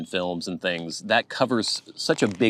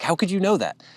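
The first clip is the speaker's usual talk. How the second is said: much higher, incredulous question; retold astonishment; 11 semitones higher than usual. Coworker asking how she guessed he's Canadian, astonished. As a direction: imitate him being amazed you could tell.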